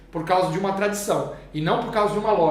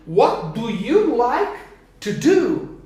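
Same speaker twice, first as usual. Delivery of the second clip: slow model; 3 semitones higher, much slower than usual. Shown as a demonstration. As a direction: spell out "What do you like to do" slowly for the class. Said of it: "teacher builds the question slowly".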